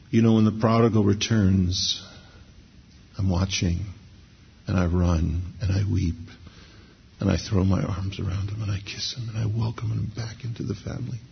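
soft and hushed, gently weeping; tender voice of the Father. Acted. Preacher voicing God, soft and tender, embracing the prodigal. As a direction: speak as a loving father welcoming his son home. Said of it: "he would stoop down to us and say".

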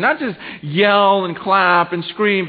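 slow, loud, every word hammered; emphatic plea. Retold or imitated. Congregant telling pastor, slow emphatic hammered plea. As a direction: drive it home slowly, word by word.

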